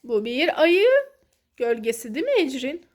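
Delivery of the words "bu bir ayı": usual speaking voice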